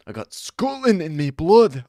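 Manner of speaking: high, loud put-on Scottish boast; hammed-up Scottish accent; mock Scottish accent. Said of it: "I've got Scotland".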